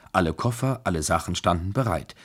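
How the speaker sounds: plain reading voice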